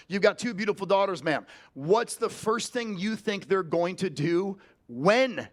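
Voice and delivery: slow, with weight on "not if"; demo sales question. Shown as a demonstration. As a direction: lean on "not if, when" to sell certainty. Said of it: "ask them".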